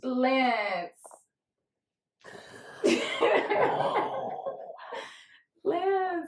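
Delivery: nervous high wobble with a laugh; shaky nervous falsetto. Real, not acted. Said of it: "I'm already, like, shaking".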